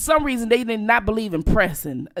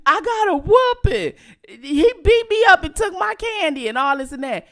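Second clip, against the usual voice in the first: put-on kid complaining; 8 semitones higher, 4 dB louder than usual. Kid complaining to uncle on phone.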